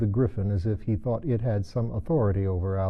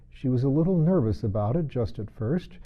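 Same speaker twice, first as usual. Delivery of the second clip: ordinary delivery, nothing marked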